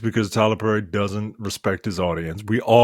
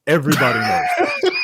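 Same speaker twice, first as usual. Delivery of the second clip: bursting into a laugh, much higher; laughing shout. Real, not acted. Co-host mocking the movie's physics, laughing shout. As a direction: crack up on the punchline. Sarcastic physics bit collapsing.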